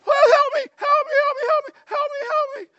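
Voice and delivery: very high, loud mock wailing; rapid repeated cries in a put-on high voice; mock crying out. Preacher imitating the woman who wouldn't quit crying, mock wail. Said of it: "she wouldn't quit crying".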